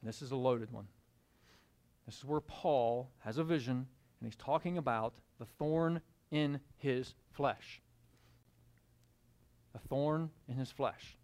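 hushed, low reading of the verse; soft reading. Acted. Preacher softly reading scripture to the congregation. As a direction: drop to a near-murmur for the text.

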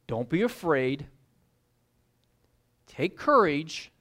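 soothing Jesus, slowed right down; gentle reassurance. Acted. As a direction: settle a frightened friend. Jesus comforting frightened disciples.

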